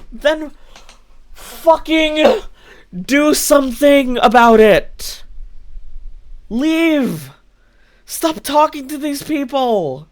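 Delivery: loud exasperated order to leave; frustrated outburst. Real, not acted. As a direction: snap at the friend to just leave. Frustrated scolding of friend.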